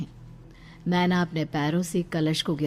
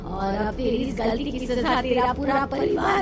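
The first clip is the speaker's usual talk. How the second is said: higher, eerie threatening curse; vengeful ghost; 7 semitones higher than usual. Acted. The ghost in Naina curses her mother-in-law, higher and eerie.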